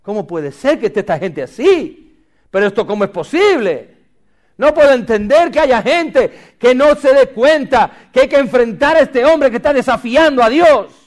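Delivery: loud, rising indignation; indignant David. Acted. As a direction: protest in outraged disbelief. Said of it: "cuando llega allí dice".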